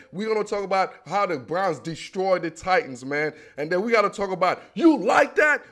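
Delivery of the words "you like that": loud, high-pitched catchphrase yell; excited, raised-voice victory cry; Kirk Cousins. Retold or imitated. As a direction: shout it out like a hyped quarterback. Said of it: "Oh, my man, Kirk Cousins".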